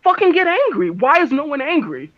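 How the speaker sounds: exasperated yelling at the group; frustrated outburst. Real, not acted. Angry outburst at calm party.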